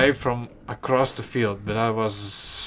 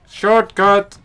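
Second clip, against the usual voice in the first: excited callout; 7 semitones higher, 8 dB louder than usual. Real, not acted. Calling out.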